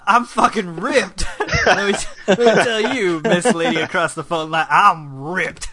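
loud, laughing mock macho boast; loud macho bragging. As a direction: brag loudly like a meathead. Flirting with the phone agent.

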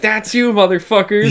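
imitating the comedian's punchline; mock jab. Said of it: "he's like".

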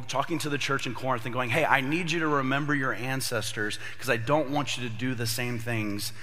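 ordinary talk

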